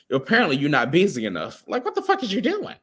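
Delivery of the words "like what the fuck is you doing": high, incredulous squeak; incredulous high-pitched quote; high mock outrage. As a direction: play the baffled partner, pitched high. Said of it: "imagined reaction in bed".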